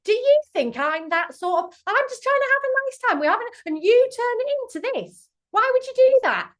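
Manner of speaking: shrill-free but loud, high offended partner shouting; indignant outburst. Pretend. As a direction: blow up and turn the blame on her. Partner acts hurt, turns blame.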